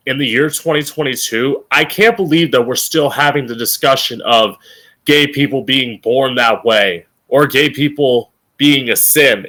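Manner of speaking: ordinary talk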